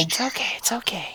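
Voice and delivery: soothing whisper. Acted. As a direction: hush her like a crying child. Husband hushing crying wife.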